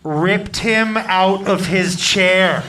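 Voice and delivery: low, heavy, drawn-out punch line; dramatic emphasis. Retold or imitated. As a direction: stretch every word out forcefully. Dramatizes the attack in his story.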